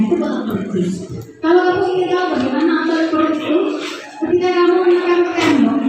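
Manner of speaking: ordinary talk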